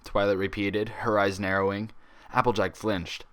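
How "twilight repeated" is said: plain reading voice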